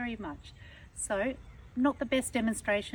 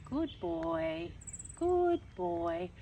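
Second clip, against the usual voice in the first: calm holding tone; 3 semitones lower, much slower than usual. Shown as a demonstration. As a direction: settle the dog and keep him waiting. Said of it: "we might use the tone".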